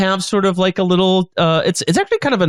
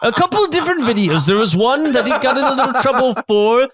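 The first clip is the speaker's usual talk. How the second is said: chef telling a dubious story with relish; name-drop proudly in a lifted character voice; self-satisfied theatrical storyteller; boastful chef character; 5 semitones higher than usual. Acted.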